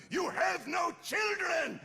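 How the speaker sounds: high, loud, drawn-out outburst; slow acted shout hurled back in an argument; shouted retort. Acted retort in retold improv quarrel.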